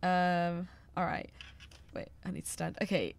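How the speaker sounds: normal talking voice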